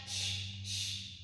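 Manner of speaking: breathy unvoiced shh sounds like a robot; robot shushing. Teacher making robot breath for kids, unvoiced shh. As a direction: hiss out short robot shushes.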